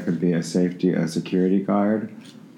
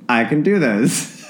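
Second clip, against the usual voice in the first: mock determination; 7 semitones higher, slower than usual. Mock resolve.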